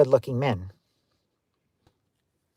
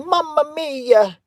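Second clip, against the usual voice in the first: high, drawn-out Italian caricature; mock yelling; 11 semitones higher, slower than usual. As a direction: cry it out slowly like a cartoon Italian. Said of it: "Men with mustaches yelling".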